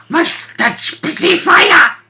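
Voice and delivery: slow, high, dim-witted caveman grunt. Retold or imitated. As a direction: play a dumb brute craving fire. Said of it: "it's basically like".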